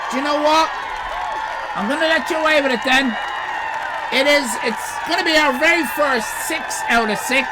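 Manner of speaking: loud, high and fast delight; excited shout. Real, not acted. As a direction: cheer the score out loud. Excited scoring of a drawing.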